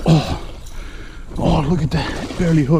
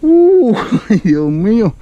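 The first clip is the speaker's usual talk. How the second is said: laughing relief; 8 semitones higher, 7 dB louder, slower than usual. Real, not acted. Fish almost breaks off.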